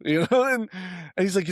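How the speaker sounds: ordinary delivery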